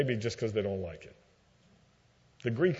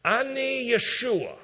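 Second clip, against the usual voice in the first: divine voice in Hebrew; 10 semitones higher than usual. Retold or imitated. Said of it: "So he says".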